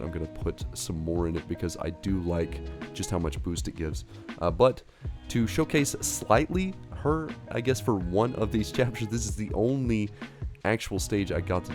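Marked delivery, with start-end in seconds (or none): none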